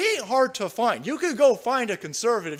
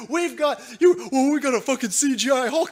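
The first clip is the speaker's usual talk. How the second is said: ordinary talk, nothing marked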